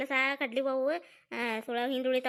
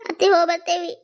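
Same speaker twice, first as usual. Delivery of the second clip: acted tearful goodbye, louder; tearful farewell; 3 semitones higher, 8 dB louder than usual. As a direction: say goodbye through tears. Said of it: "ಯಾಕ್ ಅಳ್ತೀರಿ ಕಮಲ". (ಅಳುತ್ತಾ ಬೀಳ್ಕೊಡುಗೆ)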